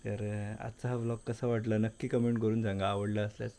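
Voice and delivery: hushed, low voice so nobody wakes; quiet at night. Real, not acted. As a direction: keep it soft, family's asleep. Home late at night, everyone asleep.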